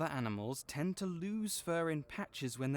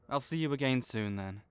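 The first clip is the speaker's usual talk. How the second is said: ordinary talk, nothing marked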